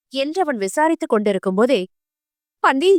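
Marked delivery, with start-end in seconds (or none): 2.6-2.9 anxious cry of the name, voiced lightly; mother rushing in, calling out in a panic; panicked call. A narrator's reading. Mother bursting in, a lightly voiced panicked call. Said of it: "என்று பதறிக்கொண்டு வந்தார்".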